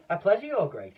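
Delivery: high, loud eager reply; eager cry. Acted. Pelican eagerly answering the Duke, high and loud. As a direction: jump at the chance. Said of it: "cried the pelican".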